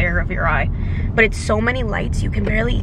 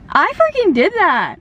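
slow, higher, emphatic; proud disbelief; 8 semitones higher, 4 dB louder than usual. Real, not acted. Telling viewers she did the surgery, slow and proud. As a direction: savor each word proudly.

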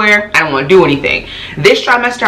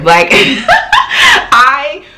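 laughing; 9 semitones higher than usual. Real, not acted. Laughing exasperated.

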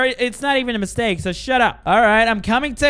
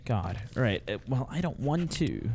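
very low, soft, reluctant murmur; reluctant mutter; 11 semitones lower, 13 dB quieter than usual. Real, not acted. Player told to shine the light closer, reluctant mutter. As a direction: shrink back, barely voicing it.

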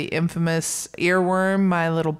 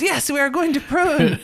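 high, eager echo of a bandit; hammed-up agreement, pitched way up; echoing bandit sidekick; 10 semitones higher than usual. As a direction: imitate the henchman repeating it emphatically. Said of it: "And the guy repeats it".

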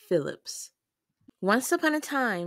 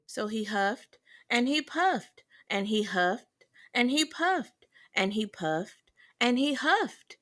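ordinary delivery, nothing marked

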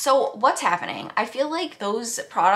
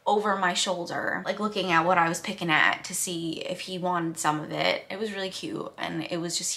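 ordinary delivery

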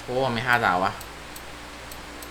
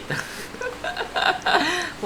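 sheepish high laugh; 8 dB quieter than usual. Real, not acted. Admits he's too lazy to switch off.

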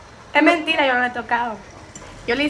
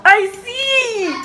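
pained cry; 8 semitones higher than usual. Real, not acted. Grita por el picante.

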